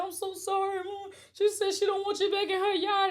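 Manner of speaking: high, strained, half-sobbing voice; retold tearful apology. Telling her friend's mom on the phone, mildly tearful. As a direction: replay a teenager's teary phone plea. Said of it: "I'm crying".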